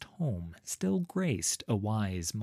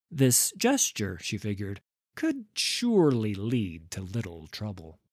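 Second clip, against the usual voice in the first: ordinary delivery, nothing marked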